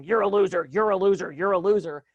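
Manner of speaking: loud, harsh repeated put-down; a critical father's voice hammering it in. Retold or imitated. Voicing a critical father's inner voice, loud and harsh. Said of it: "your father in your head going".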